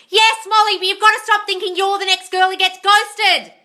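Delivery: loud high-pitched lecturing yell. Real, not acted. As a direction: shout the advice at her, fired up. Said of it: "I'm in trouble for yelling".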